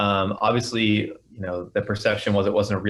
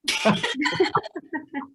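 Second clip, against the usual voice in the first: high laugh. Real, not acted. Laughing at own joke.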